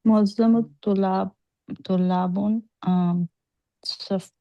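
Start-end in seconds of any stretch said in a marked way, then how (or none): none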